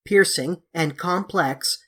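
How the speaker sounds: plain reading voice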